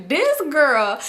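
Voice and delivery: ordinary delivery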